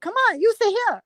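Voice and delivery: put-on high, fast Chinese-accented summons; mock Chinese accent. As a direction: hurry a client in an imitated Chinese accent. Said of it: "she does a perfect Chinese person".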